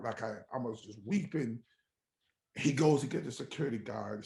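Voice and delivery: ordinary talk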